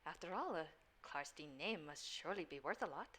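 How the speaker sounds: a hushed, coaxing stranger making a request; softly persuade, dropping to a near whisper; lightly voiced stranger. A hint of a mysterious stranger pleading with Johan.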